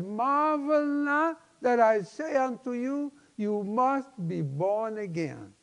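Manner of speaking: usual speaking voice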